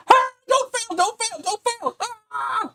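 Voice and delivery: high, squeaky panicked begging; panicked pleading. Retold or imitated. Maker in the corner begging the print, high panic. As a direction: plead with the print not to fail. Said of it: "I'm in the corner like".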